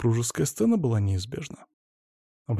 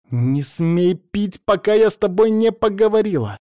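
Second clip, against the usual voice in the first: furious shout; 9 semitones higher, 5 dB louder than usual. A narrator's reading. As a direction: flare up and forbid him. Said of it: "взвилась Даша".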